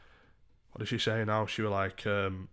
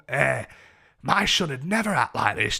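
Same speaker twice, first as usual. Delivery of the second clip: mock complaint, louder; disapproving drunk woman; 3 semitones higher, 6 dB louder than usual. Woman complaining to his mum about him, disapproving. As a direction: imitate her indignant comparison.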